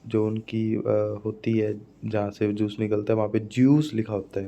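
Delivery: ordinary delivery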